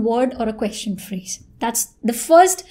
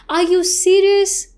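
"Are you serious" slow, held high to the end; raised intonation; 5 semitones higher, slower than usual. Shown as a demonstration. Teacher demonstrating a closed-end question, slow with raised ending. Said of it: "raise the intonation towards the end".

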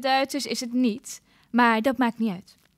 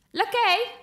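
rising, louder call for the servant; 8 semitones higher, 4 dB louder than usual. Acted. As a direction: summon the footman sharply. Queen calls for her servant.

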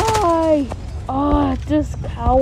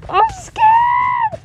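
scared shriek. Real, not acted. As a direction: squeal it out like you're terrified.

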